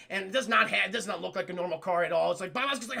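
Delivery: usual speaking voice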